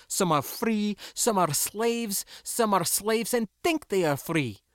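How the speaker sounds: high, loud fervent speech; impassioned tirade. A narrator's reading. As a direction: rail at them with conviction. Furious girl's tirade.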